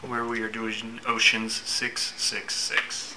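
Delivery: loud hyped show opener; announcer intro. Acted.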